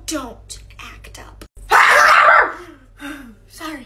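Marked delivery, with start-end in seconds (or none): 1.7-2.5 sudden loud shout-gasp; sharp yell. Acted. Sick teacher bursting out at noisy class, sharp shout. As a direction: snap in a burst.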